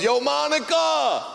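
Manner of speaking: high, slow mock street yell; yelling across tracks. Guy on the train yelling across the tracks at Monica, drawn-out high yell. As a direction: call out across the subway like a kid yelling. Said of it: "This guy screams across the tracks".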